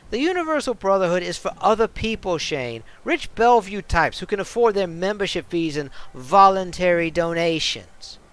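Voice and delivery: put-on tough bartender snapping back in a New York accent; defiant New York accent. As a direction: put him in his place, New York style. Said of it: "a bit of a New York-ish kind of accent".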